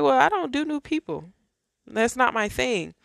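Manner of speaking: high, mock-stubborn refusal, half-joking; stubborn protest. To God, refusing new people, high mock-stubborn whine. Retelling her reply to God.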